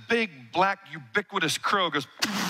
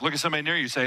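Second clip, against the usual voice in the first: ordinary talk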